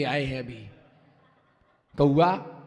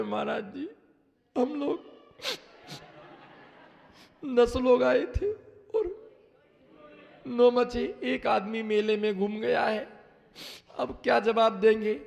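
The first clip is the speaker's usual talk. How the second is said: mock crying; 5 semitones higher than usual. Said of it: "सिर पटक करके और रोने लगे".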